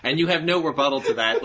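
ordinary delivery

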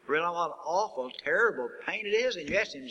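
ordinary talk

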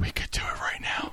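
whispered, as if plotting mischief; hushed, sneaky retold aside; conspiratorial whisper. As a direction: whisper a tempting plan to your accomplice. Retold scheming beside live broadcast.

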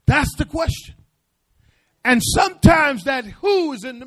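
high, loud run of ain'ts; preaching call-out. Acted. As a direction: hammer each line at the church. Preacher calling out.

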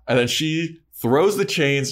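usual speaking voice

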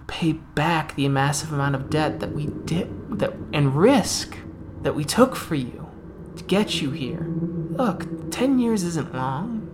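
lightly voiced handler talking a captive round; coaxing captor. Captor to weeping man in a cell, mildly coaxing. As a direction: cajole with a hint of false reassurance.

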